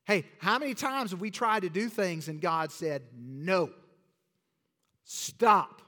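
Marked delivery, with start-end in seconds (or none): 3.1-5.8 loud, sharp no then stop; firm command. Acted. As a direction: halt them with a sharp command. God voiced saying no.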